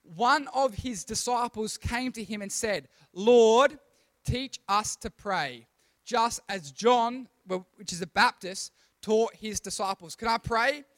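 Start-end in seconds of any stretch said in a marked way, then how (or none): 3.1-10.1 loud scripture reading. Shown as a demonstration. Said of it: "Are we ready to read this?".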